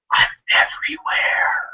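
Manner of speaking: slow, leaning-in whisper; whispered, mock ominous; mysterious whisper. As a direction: breathe it like a spooky secret. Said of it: "He leans in".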